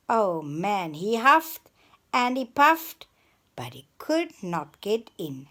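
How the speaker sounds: ordinary delivery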